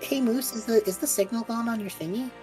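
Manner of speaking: normal talking voice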